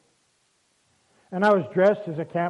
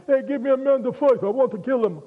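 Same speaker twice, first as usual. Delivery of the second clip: higher-pitched mock giant's challenge; Goliath's shout; 6 semitones higher than usual. Goliath dares the Israelites to send a man, mock shouting. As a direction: call it out like a taunting warrior, half-shouted. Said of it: "shouting out, saying".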